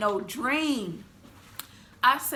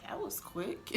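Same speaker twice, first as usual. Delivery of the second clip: quiet wry aside; 14 dB quieter, slower than usual. Retold or imitated. Hearing siren, wry.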